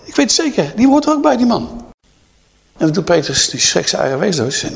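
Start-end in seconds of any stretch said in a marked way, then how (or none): 0.0-1.7 shrill accuser turning to the crowd; accusing servant girl. Acted. Servant girl telling the others about Peter, very high. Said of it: "zegt ze".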